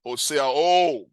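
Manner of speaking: "Hotseao" with the final o held long and loud; lengthened final vowel. Shown as a demonstration. Teacher showing congregation the longer vowel, "Hotseao" with drawn-out last o. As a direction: stretch the end of "Hotseao" for emphasis. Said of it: "you make a, a longer vowel".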